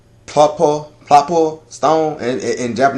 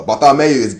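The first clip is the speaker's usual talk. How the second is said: ordinary talk, nothing marked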